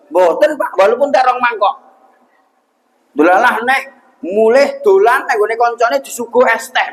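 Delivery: ordinary delivery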